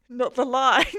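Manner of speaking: loud, through a burst of laughter; laughing out loud; real laughing. Real, not acted. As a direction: crack up while saying it. Cracking up at a chat message.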